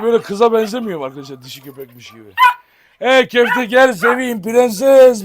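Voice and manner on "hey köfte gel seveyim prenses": high, loud and slow pet talk; real doting call to a puppy; cooing at the dog. Real, not acted. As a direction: coax the puppy over lovingly.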